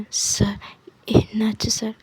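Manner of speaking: timid whispered question to the stern doctor; nervous whisper. Acted. Nervous student asks the glaring doctor, whispering. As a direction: whisper it, scared of him.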